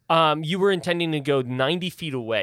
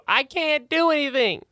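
yelling snake; 12 semitones higher, 3 dB louder than usual. Acted. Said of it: "yelling down to you".

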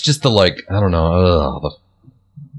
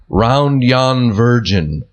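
quoting the carol line; much slower than usual. Shown as a demonstration. Singer quotes the puzzling carol line to friends, drawn out slowly. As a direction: ROUND YON VIRGIN drawn out very slowly. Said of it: "When they say the line".

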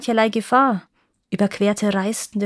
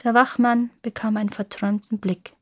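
ordinary delivery

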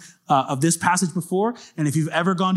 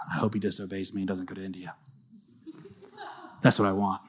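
much softer, fast, secretive mock aside; scheming aside; 7 semitones lower, 9 dB quieter, faster than usual. A mock God scheming in secret, much softer. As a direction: murmur it like a sly hidden plan. Mocking God's supposed secret agenda.

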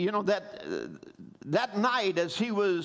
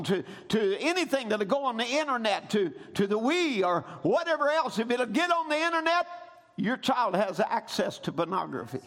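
ordinary talk

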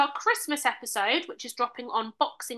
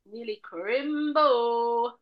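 silly; much slower than usual. Acted. Silly drawn-out cheer about Christmas.

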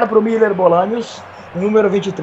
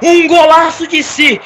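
slow, shouted goal celebration; explosive goal commentary; 5 semitones higher, 3 dB louder, much slower than usual. Acted. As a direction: savor every word of the goal. (narração de golaço)

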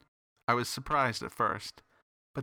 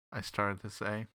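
ordinary delivery, nothing marked

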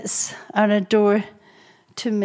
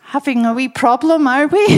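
loud, higher playful tease; teasing; 4 semitones higher, 7 dB louder than usual. Retold or imitated. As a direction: call them out cheekily, loud and high. Teasing the quarrelling couple.

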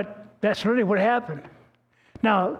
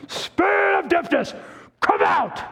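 high, booming healer's command; desperate imitation of a faith healer; retold yell; 10 semitones higher, 3 dB louder than usual. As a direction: yell it like a TV preacher. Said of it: "What are you yelling about?".

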